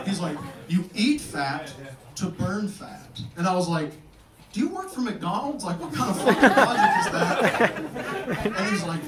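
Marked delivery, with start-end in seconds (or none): 0.7-3.2 slow explaining. Retold or imitated. Nutritionist explains slowly. 4.5-8.4 higher, laughing disbelief; mock outrage. Comic to the nutritionist, laughing incredulous scoff.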